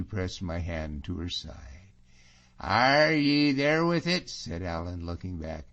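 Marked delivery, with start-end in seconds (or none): 2.6-4.3 high, slow, louder Scots question; amused surprise. Acted. Allan looking back at the engaged couple, slow surprised question. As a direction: stretch it out, raised in surprise. Allan reacts to marriage news.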